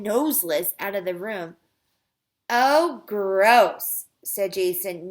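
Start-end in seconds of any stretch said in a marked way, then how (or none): 2.5-4.0 loud, drawn-out disgusted pupil; Allison's voice. A narrator's reading. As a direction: lightly voice a grossed-out girl. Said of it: "Now I sound like Allison".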